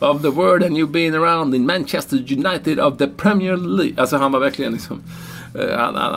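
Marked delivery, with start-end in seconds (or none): 0.1-3.9 high, hammed-up effusive interviewer; Spanish TV reporter. TV reporter heaping praise on a star player, hammed-up and long-winded. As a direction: gush grandly in mock reporter English. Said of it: "han pratade i en minut".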